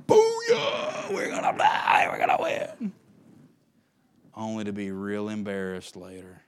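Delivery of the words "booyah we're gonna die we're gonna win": shouted mock trash talk, high and loud. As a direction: crow like a cocky fan. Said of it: "start trash talking".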